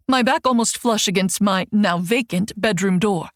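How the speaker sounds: ordinary delivery, nothing marked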